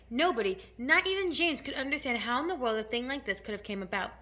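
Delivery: ordinary delivery, nothing marked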